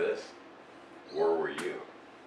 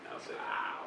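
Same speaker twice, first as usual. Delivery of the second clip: whispered aside; 8 dB quieter, slower than usual. Real, not acted. Muttered aside about being recorded.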